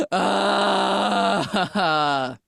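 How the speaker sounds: loud high gasp; frightened gasp. Retold or imitated. Hiding under the desk, she gasps in fright. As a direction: gasp as if nearly caught.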